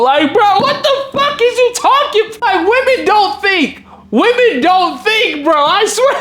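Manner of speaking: high-pitched, incredulous venting; exasperated rant. Real, not acted. Venting to viewers about her texts, exasperated.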